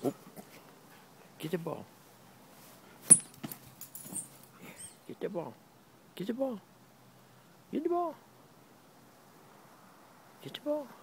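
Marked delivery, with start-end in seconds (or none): 1.4-10.9 each get the ball higher and more pleading; rising eager dog-coaxing; coaxing, climbing higher. Real, not acted. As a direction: climb up in pitch urging the dog on. Urging dog, rising each time.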